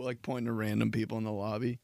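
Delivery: ordinary talk, nothing marked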